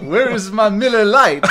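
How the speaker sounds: yokel shout. Acted. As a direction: shout it like a beer-craving yokel. Yokel voice after French joke.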